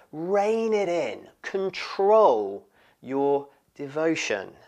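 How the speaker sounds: slow, high, emphatic telling-off; exasperated reproof. Acted. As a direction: plead with her to restrain herself, word by word. Voicing disciples reproving the woman.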